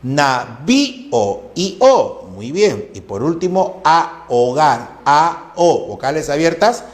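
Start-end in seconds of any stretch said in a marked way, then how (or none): 0.0-2.3 "Navío" very slow, as na-ví-o; hiatus shown. Shown as a demonstration. 3.8-5.9 "ahogar" slow, as a-o-gar; hiatus separation. Shown as a demonstration. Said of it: "se separan".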